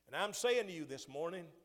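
loud, high call to the congregation; full-force preaching. Real, not acted. Preacher calling to his flock, loud and high. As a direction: preach it out, lifting the voice.

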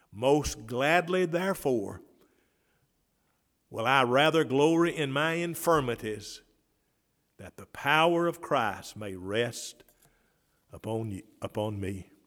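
loud, slow preached verse; preaching scripture. Acted. Pastor proclaiming Paul's words to the church, loud and slow. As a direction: proclaim it slowly and loudly.

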